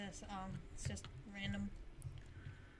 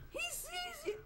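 much higher, thin panicked squeak; high alarmed squeal; squealed warning; 7 dB quieter than usual. Real, not acted. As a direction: shriek a warning at the screen. Warning a survivor on screen.

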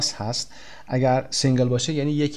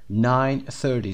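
slow clear repeat; much slower than usual. Shown as a demonstration.

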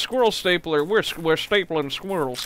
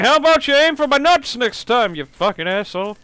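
loud, higher mocking taunt; sarcastic taunt; 6 semitones higher, 5 dB louder than usual. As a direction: sneer at the squirrel. Taunting the squirrel.

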